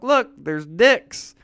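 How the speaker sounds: high, loud, slow sing-song, pointing it out; teasing sing-song. Retold or imitated. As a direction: drag it out like a taunting kid. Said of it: "every time I'm like".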